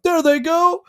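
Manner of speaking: high pitched mock monster call; lightly voiced cordyceps; infected voice. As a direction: squeal it like a zombie spotting prey. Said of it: "then the cordycep's like".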